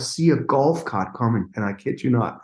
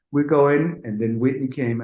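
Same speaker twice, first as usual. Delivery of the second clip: normal talking voice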